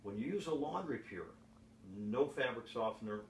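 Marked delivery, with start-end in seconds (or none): none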